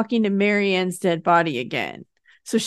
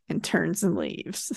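ordinary talk, nothing marked

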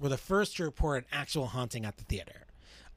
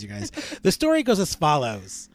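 ordinary talk, nothing marked

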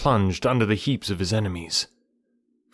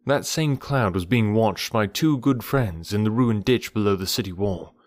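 usual speaking voice

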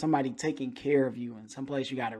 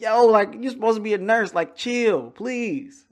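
retold scolding; 8 semitones higher, 6 dB louder than usual. Retold scolding of rough nurse.

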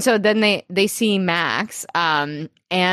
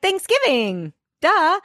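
sassy duh; 11 semitones higher than usual. Retold or imitated. Quoting Zoya.

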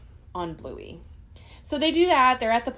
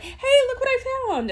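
high, fast, excited dad; 5 dB louder, much faster than usual. Retold or imitated. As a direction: squeal it out like a proud dad. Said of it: "he just pulls it out like".

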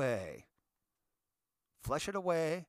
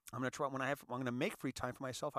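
normal talking voice

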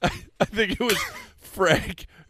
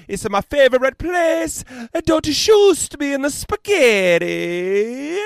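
loud, high-pitched mock Italian; hammed-up cartoon Italian accent, exclaiming; 7 semitones higher, 6 dB louder than usual. [name] voices the Cornish Hen accepting the award, Italian accent. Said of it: "I don't know why I went Italian with it".

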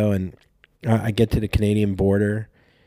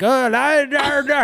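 mock Scottish babble; slower than usual. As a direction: babble like a drunk Scot with a strong mock Scottish lilt. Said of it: "talking to Scottish people, be like".

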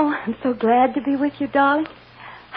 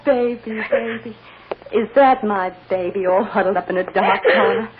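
ordinary talk

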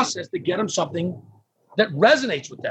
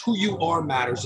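ordinary talk

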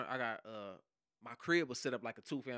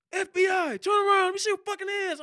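very loud, much higher commanding yell; retold agent's shout; 12 dB louder than usual. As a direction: bark orders through the doorway. Quotes FBI agent entering.

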